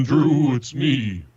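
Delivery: normal talking voice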